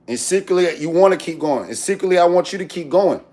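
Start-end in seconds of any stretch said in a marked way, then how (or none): none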